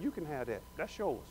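a still small voice; quiet intimate whisper; whisper of God. Acted. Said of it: "It's just a whisper".

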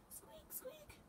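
tiny high-pitched squeaks; lantern squeak. A narrator's reading. Reader mimics lantern swinging in the dark, squeaky. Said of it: "went the lanterns".

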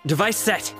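high, quick announcement; battle callout. Acted. Operator deploying a device in battle, high callout. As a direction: call it out to the team.

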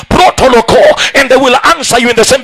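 usual speaking voice

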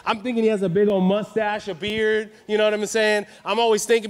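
ordinary delivery

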